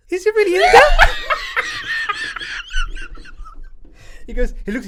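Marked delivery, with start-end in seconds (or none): 0.1-0.7 high, loud, squealing through laughter; can barely get it out laughing; laughing quote. Retold or imitated. 0.8-4.2 very high squealing laughter; helpless high cackle; shrieking laugh. Real, not acted. Cracking up at the quote.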